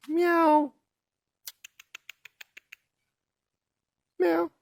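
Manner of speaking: high imitated cat meow; high meow imitation. Real, not acted. Looking for the cat, meows high at her.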